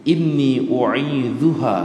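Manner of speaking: slow melodic chanting of the verse; drawn-out Arabic recitation; Quranic recitation. Acted. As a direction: recite it slowly like prayer.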